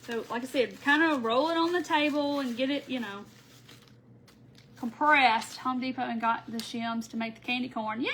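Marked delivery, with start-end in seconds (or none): none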